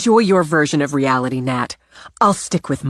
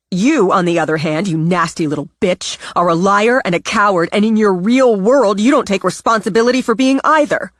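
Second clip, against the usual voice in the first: louder and rising, tearing into her sister; angry outburst; 5 semitones higher, 7 dB louder than usual. Acted. Simone unloading on her sister Natalie, loud and furious. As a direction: let the anger climb until it bursts. Simone finally fights back.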